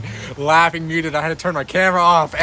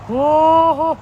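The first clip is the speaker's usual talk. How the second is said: pet voice; 11 semitones higher, 4 dB louder than usual. Real, not acted. Greeting dog in pet voice.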